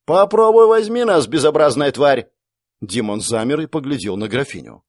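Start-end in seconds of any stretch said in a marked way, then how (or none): 0.0-2.3 высоко и громко, с вызовом; бросить вызов чудовищу во весь голос; пафосный крик. Acted. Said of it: "пафосно закричала". (графиня пафосно кричит демону, громкий вызов)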